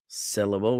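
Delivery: "syllable" as SIL-uh-bul; stress on SI. Shown as a demonstration. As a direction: emphasise the first "si" of "syllable". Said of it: "with the emphasis on sit".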